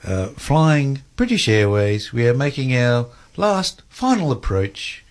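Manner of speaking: slow, measured pilot's cabin announcement; drawn-out British Airways captain on the intercom; airline pilot announcement. Retold or imitated. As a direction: spell out the final approach, slowly and gravely. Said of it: "he said, uh".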